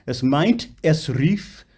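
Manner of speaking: "meint" and "rief" with crisply spat endings; spat final consonants. Shown as a demonstration. Coach showing singer clear endings, spat consonants on "meint" and "rief". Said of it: "spit the final consonants".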